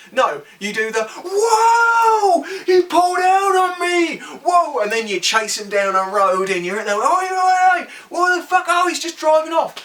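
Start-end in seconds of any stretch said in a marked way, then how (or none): 1.2-4.8 loud, high hammed-up whoa of indignation; exaggerated shocked shout, much higher; mock outrage. Mocking biker's outraged reaction. 6.9-9.7 loud, high hammed-up yelling after a car; over-the-top angry biker chasing a car; mock angry shouting.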